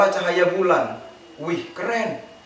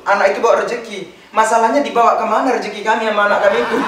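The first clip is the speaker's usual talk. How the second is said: normal talking voice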